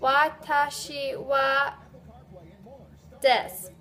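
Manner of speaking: slow model with a gap for the name; teacher leading a class drill; slow repeat-after-me. Shown as a demonstration. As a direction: leave a pause for them to fill in. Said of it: "put your name into it".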